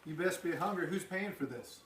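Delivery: hammed-up diner cook rushed off his feet; Earl the cook. Teacher as cook Earl takes the kids' orders, hammed up.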